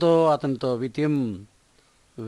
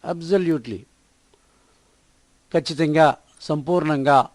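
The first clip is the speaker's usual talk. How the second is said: ordinary talk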